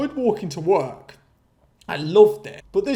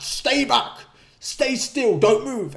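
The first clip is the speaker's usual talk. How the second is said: panicked owner shouting; 6 semitones higher, 3 dB louder, slower than usual. Retold or imitated. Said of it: "starts freaking out".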